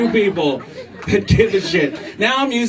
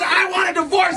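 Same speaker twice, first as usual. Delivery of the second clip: high-pitched mock yell as the wife; hammed-up angry retort; outraged wife shout; 14 semitones higher than usual. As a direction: shriek back in a comic quarrel. Wife's retort in acted quarrel.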